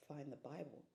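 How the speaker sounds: very soft, low, puzzled echo; hushed questioning. Retold or imitated. Woman questioning God's instruction, hushed and low. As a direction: query it almost under the breath. She questions the inner command.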